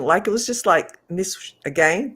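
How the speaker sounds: ordinary delivery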